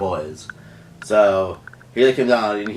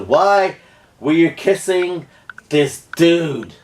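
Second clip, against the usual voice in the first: high, louder accusation, word by word; retold confrontation; 7 semitones higher, 4 dB louder, much slower than usual. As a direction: lay into the cheating boyfriend, drawn out. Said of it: "that's where he starts to really".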